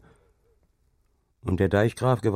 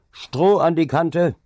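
loud, high, slow order; commanding call; 10 semitones higher, 6 dB louder, slower than usual. A narrator's reading. Dike reeve commands the carter from horseback, loud and imperious. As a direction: bark it from the saddle. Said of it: "rief er herrisch".

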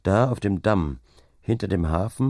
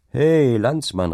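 higher, louder, drawn-out hail; calling out; 6 semitones higher, 5 dB louder, slower than usual. A narrator's reading. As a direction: call out slowly to a passing stranger. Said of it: "rief ich". (langgezogener, lauter Zuruf)

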